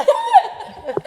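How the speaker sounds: very high loud laughing what; shrill yelled what. Retold or imitated. Answering a call across the house, a loud high laughing what. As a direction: yell back, way up high.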